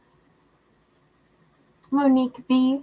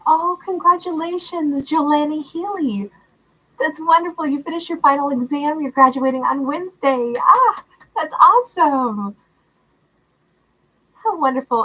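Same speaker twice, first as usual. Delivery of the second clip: higher and louder, bubbling with delight; excited congratulations; 6 semitones higher, 4 dB louder than usual. Real, not acted. Streamer congratulating a viewer on graduating, excited. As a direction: cheer her on with real joy.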